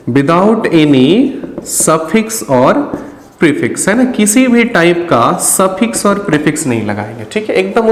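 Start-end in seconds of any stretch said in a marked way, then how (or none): 0.1-3.9 very slow, spaced-out words; drawn out with pauses between words; slow dictation. Real, not acted. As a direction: dictate it word by word as you write. Dictating while writing out the rule.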